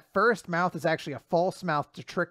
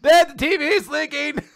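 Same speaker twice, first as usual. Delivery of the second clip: put-on alarmed kid; 8 semitones higher than usual. Child reporting the TV to Dad, high mock alarm. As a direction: yell for a parent, hammed-up.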